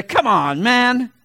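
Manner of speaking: loud incredulous, rising on man; Chris Carter catchphrase. Retold or imitated. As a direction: come on, man as doubting TV pundit. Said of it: "Chris Carter will be sitting there".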